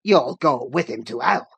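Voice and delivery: master's forceful rebuke; angry exclamation. A narrator's reading. Master overruling the young man's offer, angry exclamation. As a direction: cut him down with force. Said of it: "exclaimed his master".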